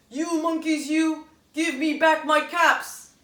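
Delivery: loud, slow scolding of the monkeys; mad scolding. Acted. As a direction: scold them with a pointed finger. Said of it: "The man gets mad".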